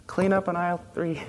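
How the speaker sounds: high-pitched mock supermarket announcement; store announcer. Store worker calls for a spill cleanup, announcer voice.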